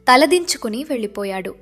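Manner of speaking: ordinary talk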